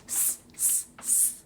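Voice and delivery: long unvoiced hiss, s s s; hissing s sound. Shown as a demonstration. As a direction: hiss the s like a sizzling sausage. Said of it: "Do that with me".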